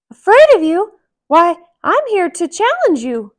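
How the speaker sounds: high, loud bold mouse voice; Mickey voice. Acted. Mickey daring the giant, high and bold. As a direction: challenge him in a high mouse voice. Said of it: "said Mickey".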